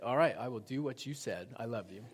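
soft, low, dutiful husband voice; meek agreement. Retold or imitated. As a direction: give in quietly, voice dropped low. Husband meekly agrees with wife on phone.

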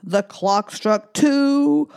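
slow announcing. Pretend. Counting song, clock strikes.

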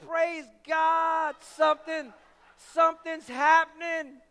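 high, excited shout as if a miracle begins; excited praise. Retold or imitated. Student thinks friend's healing has started, shouting excitedly.

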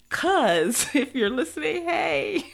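giggly greeting. Real, not acted. Calling out to a fourth cousin in Australia, laughing. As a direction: laugh out a hello to a long-lost cousin.

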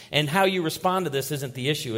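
usual speaking voice

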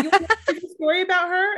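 high, loud, quick re-enactment; eager offer. Retold or imitated. Eager offer to strangers retold.

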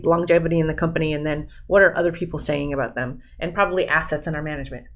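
ordinary talk, nothing marked

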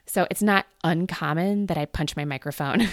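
ordinary talk

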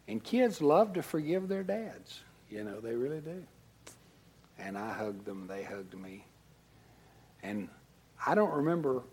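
usual speaking voice

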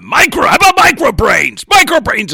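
high, loud eureka shout; excited shout. Pretend. Gene shouting out his naming idea, loud and high. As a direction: blurt out the bright idea.

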